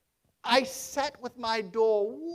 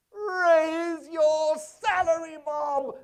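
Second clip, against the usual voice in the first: slow, louder, beaming reveal; spring the good news slowly; joyful reveal; 5 semitones higher, 4 dB louder, much slower than usual. Acted. Scrooge springing the raise on Bob, slow and beaming.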